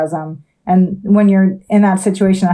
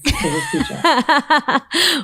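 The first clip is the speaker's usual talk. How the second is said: laughing; 11 semitones higher, much slower than usual. Real, not acted. Laughs at own thanks.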